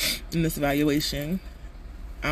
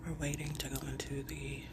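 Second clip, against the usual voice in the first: whispering; 3 semitones lower, 16 dB quieter than usual. Real, not acted. Whispering in waiting room.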